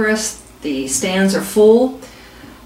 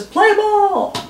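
higher, hammed-up umpire call; umpire calling play ball; 11 semitones higher than usual. Umpire restarts the game, hammed-up umpire call. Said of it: "Umpire says".

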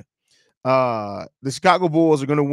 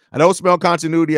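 usual speaking voice

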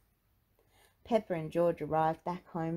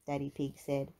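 ordinary delivery, nothing marked

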